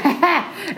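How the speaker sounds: high mock laugh, "ha, ha!"; exhilarated cackle. Woman floating out of her body, high giddy laugh.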